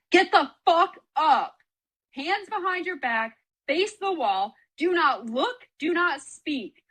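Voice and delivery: high, slow barked orders; menacing shouted orders; mock drill sergeant yell. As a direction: bark the commands like a hazing senior. Seniors barking hazing orders.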